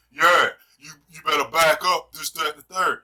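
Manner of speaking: a hint of tough man, loud at first; imitated man voice. Mimicking Kevin's sleep-talk, lightly voiced man voice. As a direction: lightly put on a loud, manly warning. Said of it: "You hear the man voice".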